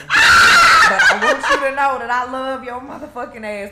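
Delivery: high, very loud laughing shout; hammed-up shouted love confession; mock yelled declaration. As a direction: holler your love at full volume. Acted bedtime declaration of love, shouted.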